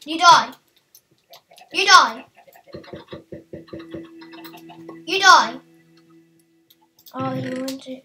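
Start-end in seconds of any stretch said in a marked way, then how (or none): 0.1-5.6 mock threat. Attacking a mob, loud threats.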